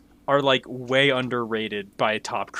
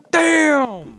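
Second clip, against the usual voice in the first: exaggerated damn; 13 semitones higher, 5 dB louder than usual. Real, not acted. Reacting to low score.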